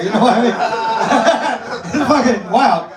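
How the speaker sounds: normal talking voice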